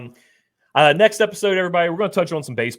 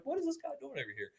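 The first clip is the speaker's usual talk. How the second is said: baffled; 12 semitones higher, 15 dB quieter, much faster than usual. Retold or imitated. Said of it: "I was like".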